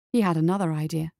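ordinary delivery

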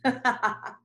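short burst of real laughter; spontaneous chuckle of amusement; amused laugh. Real, not acted. Amused by the father she channels.

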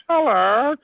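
high-pitched meow shaped like a word; cat's hello. Retold or imitated. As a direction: answer back as a cat, rising. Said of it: "And he goes".

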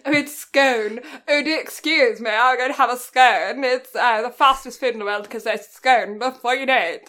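hammed-up posh British with long-O "scone"; mock-posh British, very proper. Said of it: "Do you know how posh you sound?".

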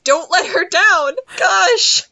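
loud, high-pitched venting; worked-up, comic frustration; exasperated outburst. Real, not acted. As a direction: yell at foolish film characters, ending in a cry. Venting at characters' foolish choice.

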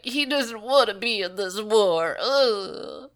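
imitating a sulky spoiled kid; mock tween whining. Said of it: "whining like, you know, a spoiled tween".

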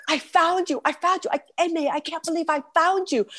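high, excited voice imitating a friend; re-enacted breathless-with-joy greeting; thrilled friend. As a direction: act out his elated relief, pitched high.

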